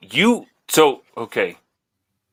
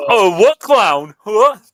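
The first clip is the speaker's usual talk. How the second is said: higher, drawn-out mock puzzlement; playing dumb; 8 semitones higher, 4 dB louder, much slower than usual. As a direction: pretend you have no idea, voice pitched up. Playing dumb about the hidden clown.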